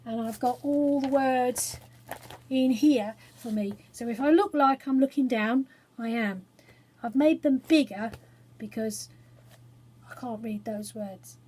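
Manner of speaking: ordinary delivery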